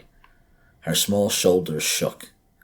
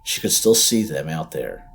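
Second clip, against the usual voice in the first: usual speaking voice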